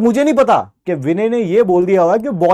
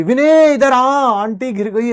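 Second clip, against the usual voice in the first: calling out; 5 semitones higher than usual. Retold or imitated. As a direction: call Vinay over urgently. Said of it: "uncle ने भी विनय को आवाज मार ली". (बेटे को ऊँची आवाज़ में बुलाना)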